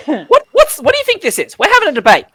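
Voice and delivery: high, loud little fairy; pixie voice. Acted. Pixie asks passers-by to settle a debate, high and loud. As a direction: pipe up as an excitable pixie. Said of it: "one of them yells".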